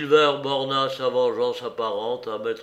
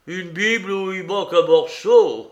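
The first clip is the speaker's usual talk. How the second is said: raised, jeering tone; mocking exclamation; 5 semitones higher, 7 dB louder than usual. A narrator's reading. Silver scoffs at Dick's torn Bible, mocking exclamation. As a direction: scoff loudly at the idea. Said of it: "s'exclama Silver, railleur".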